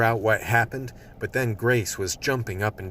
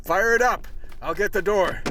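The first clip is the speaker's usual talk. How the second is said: urgent shouted orders; 11 semitones higher than usual. Acted. Partner shouting to Grace at the van, high urgent calls. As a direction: bark it out mid-escape.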